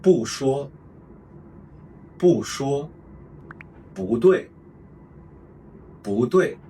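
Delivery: each bu phrase said twice, carefully; tone sandhi drills. Shown as a demonstration. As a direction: clear slow Mandarin tone demonstration. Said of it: "the ten examples".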